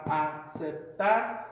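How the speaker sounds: "aceptar" slowly broken into its syllables; teacher's slow breakdown of "aceptar". Shown as a demonstration. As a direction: sound out "aceptar" one syllable at a time. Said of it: "You kinda hear that the emphasis on the last syllable".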